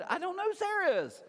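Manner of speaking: high-pitched comic protest; husband's exaggerated exasperated outburst; mock exasperation. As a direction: stretch it up high, playfully fed up. Exasperated at unknown names in wife's stories.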